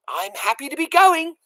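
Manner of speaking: high, content little voice; happy girl. A narrator's reading. Mig voiced.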